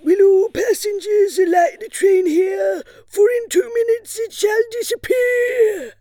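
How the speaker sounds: put-on high loud old conductor's cry; train conductor calling. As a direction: bellow the last stop like a conductor. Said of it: "the conductor shouted".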